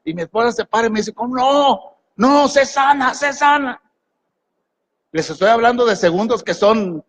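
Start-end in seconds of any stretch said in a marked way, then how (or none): 1.1-3.8 wife's urgent plea. Retold or imitated. Wife speaks in retold crisis.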